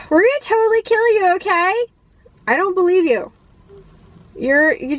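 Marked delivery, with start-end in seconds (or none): 0.1-1.8 higher, fast, breezy mock threat; mock villain girls. Vlogger voicing the girls threatening Keanu, higher breezy mock menace. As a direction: toss off the threat like a bored teen.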